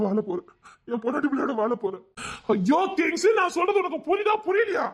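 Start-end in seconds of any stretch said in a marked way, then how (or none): 2.5-4.8 higher and louder, yelling in frustration; angry shout. Acted. David yells at stubborn Kings, furious shout. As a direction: shout it at him, fed up.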